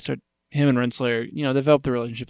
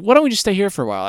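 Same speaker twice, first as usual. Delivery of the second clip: fast, louder, higher coy voice; mock clock lady; 7 semitones higher, 5 dB louder, faster than usual. Clock flirting with the scientist, coy fast voice. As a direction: flirt in a quick put-on voice. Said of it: "she's like".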